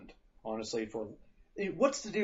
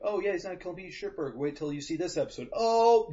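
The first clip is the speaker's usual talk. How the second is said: mock viewer reaction; 6 semitones higher, 4 dB louder than usual. Imagined viewers reacting to the episode.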